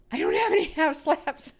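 high-pitched words breaking into giggles; amused high wail ending in laughter; laughing. Real, not acted. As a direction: laugh through the complaint. Laughing at missing half slabs.

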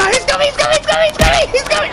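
high frantic repetition; frightened shrill warning; panicked. Real, not acted. Enemy chasing player.

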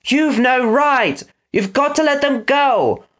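high, drawn-out protest; pleading boy hero. Acted. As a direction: plead for your friends in a high, slow voice. Eggs pleading with Snatcher in story.

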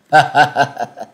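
loud laughter. A narrator's reading. Man in the forest answers Châu Thanh, loud laughter. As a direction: laugh loudly from the woods. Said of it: "Người ở trong rừng lớn tiếng cười".

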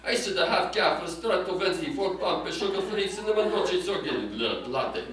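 hammed-up Italian mafia accent. Shown as a demonstration. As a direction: order it like a Sicilian mobster, Italian accent. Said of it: "if you say that with an Italian accent".

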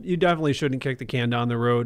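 normal talking voice

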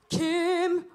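high, loud stage cry; dramatic cry. Retold or imitated. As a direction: call the name in mock horror. Acts castmate hearing the gunshot.

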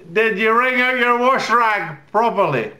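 shrill-high, loud wifely demand; high-pitched, loud mock wife nagging. Wife quizzing husband in the morning, high loud nagging. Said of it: "my wife asks me".

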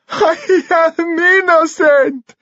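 high, drawn-out tearful wail; sobbing. A narrator's reading. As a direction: wail it slowly through acted sobs. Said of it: "he sobbed".

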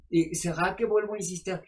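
ordinary talk, nothing marked

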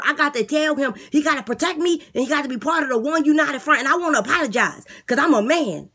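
eager inmate talking up his plan; lisping boastful inmate. Acted. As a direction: beg the guard like a cocky jailbird. Inmate mispronounces sodomy.

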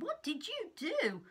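high, cross bear's cry; a mock-angry roar at the monkey; bear yelling. Bear woken up, yelling at the monkey.

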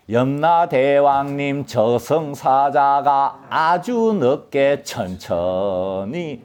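drawn-out plea, 천천히 stretched long; slow drawn-out recitation. Shown as a demonstration. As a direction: chant it very slowly, stretching every word. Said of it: "천천히는 천천히 읽어야 됩니다".

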